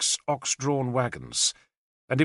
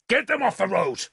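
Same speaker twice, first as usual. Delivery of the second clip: high, angry order; shouted angrily; 7 semitones higher than usual. A narrator's reading. Lord orders wagons cleared, angry shout. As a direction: snap the command at her. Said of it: "I shouted angrily".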